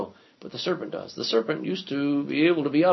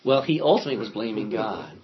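usual speaking voice

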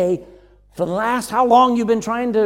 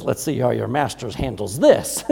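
God's voice; 9 semitones lower than usual. Retold or imitated. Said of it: "And then the Lord says".

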